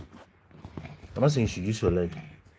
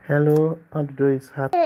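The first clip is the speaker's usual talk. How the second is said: higher, louder cooing hello; 6 semitones higher, 6 dB louder than usual. Real, not acted. Father coos at baby.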